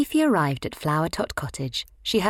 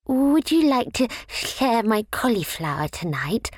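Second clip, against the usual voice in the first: shy; 7 semitones higher, 4 dB louder than usual. A narrator's reading. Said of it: "asked Slugsy shyly".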